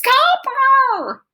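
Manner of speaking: very high, loud scream with a long fall. A narrator's reading. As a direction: shriek the word at the fireworks. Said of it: "Freak tugs my hair and screams".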